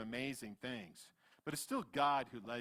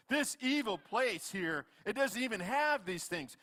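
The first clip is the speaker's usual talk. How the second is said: acted-out griping, pitched up; complaining; 6 semitones higher than usual. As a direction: grumble indignantly on behalf of the crowd. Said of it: "are complaining to Moses".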